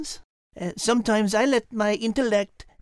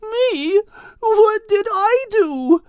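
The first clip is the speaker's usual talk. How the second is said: queasy and near tears; 11 semitones higher, 7 dB louder, slower than usual. Acted. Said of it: "almost crying from it all".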